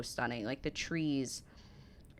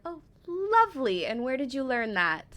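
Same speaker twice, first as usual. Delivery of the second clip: surprised mom; 9 semitones higher, 4 dB louder than usual. Retold or imitated. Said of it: "And I'm like".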